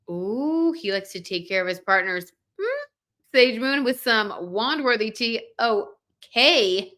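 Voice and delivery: usual speaking voice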